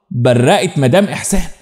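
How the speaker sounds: usual speaking voice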